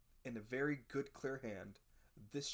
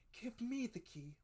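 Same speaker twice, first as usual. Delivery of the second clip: mother demanding; 11 semitones higher than usual. A narrator's reading. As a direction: ask for it in the mother's high voice. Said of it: "said my mother".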